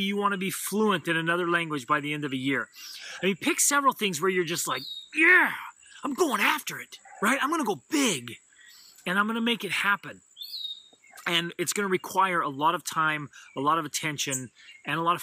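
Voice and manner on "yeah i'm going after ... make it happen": pumped-up yell. Pretend. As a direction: sound like you are going all in. Imagined goal-setter psyched up.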